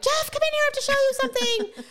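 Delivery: high, eager call to the next room; calling out excitedly. Retold or imitated. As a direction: sing out for her son to come see. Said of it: "I'm like".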